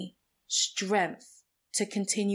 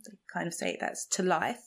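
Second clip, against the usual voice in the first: ordinary talk, nothing marked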